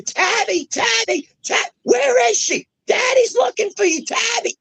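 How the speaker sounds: high desperate shouts for a lost daughter; frantic screaming. Retold or imitated. Father screaming for his missing daughter, frantic high yell. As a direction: scream her name in panic, voice cracking. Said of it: "Because I was screaming".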